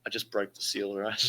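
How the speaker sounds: ordinary delivery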